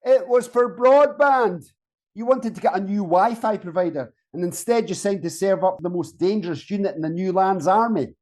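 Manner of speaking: high-pitched, incredulous scolding; exasperated reproach. Acted. Berating bunkmate over ticking wrong box.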